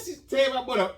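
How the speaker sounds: ordinary delivery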